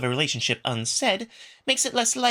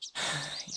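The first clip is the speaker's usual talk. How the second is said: long breathy unvoiced sigh; a quiet resigned exhale; 12 dB quieter than usual. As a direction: let out a soft, tired sigh. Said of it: "Alex sighs".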